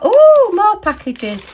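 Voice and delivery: high, loud and slow oh; thrilled, drawn-out exclamation; delighted surprise. Real, not acted. Unboxing for her viewers, loudly delighted.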